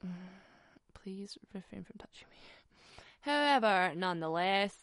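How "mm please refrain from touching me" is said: a hushed, mock-formal brush-off; murmured, very proper please refrain; whispered prim refusal. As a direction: whisper it primly and stiffly. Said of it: "I was like".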